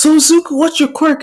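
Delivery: high, loud curious question; eager classmate. Acted. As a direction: ask brightly and excitedly.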